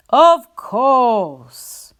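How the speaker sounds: loud, friendly woodsman voice; woodcutter. A narrator's reading. Woodcutter agreeing to sell the pig sticks. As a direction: answer heartily like a burly woodcutter. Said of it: "answered the woodcutter".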